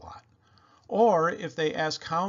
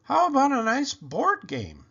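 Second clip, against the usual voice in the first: higher and louder, a mock-cheery Mom; imitating Mom; 10 semitones higher, 4 dB louder than usual. Child imitating Mom's cheery suggestion of a board game. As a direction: sell the board game like an overly cheerful parent. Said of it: "Mom always likes to say".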